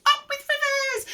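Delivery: stretched falsetto chant; joyful hooting. A narrator's reading. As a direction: hoot it out like a happy duckbill. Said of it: "And they hooted".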